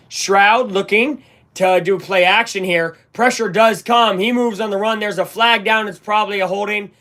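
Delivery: lifted, urgent play call; live play-by-play. Acted. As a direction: narrate pressure and the flag in real time. Calling the play as it happens.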